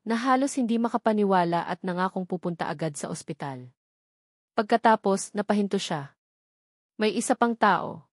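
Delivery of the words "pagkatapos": usual speaking voice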